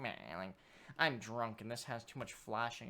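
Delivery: low, slow imitation of a bored viewer; half-hearted drunken grumble, mimicking a partner; mock complaint. Host mimics co-host giving up on the movie, mock complaint. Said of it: "you're like".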